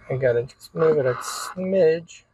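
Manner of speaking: ordinary talk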